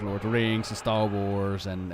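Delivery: ordinary talk, nothing marked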